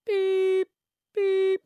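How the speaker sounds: high, loud sung beeps; radio news beeps. Shown as a demonstration. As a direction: imitate the news-coming beeps. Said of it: "so it's like".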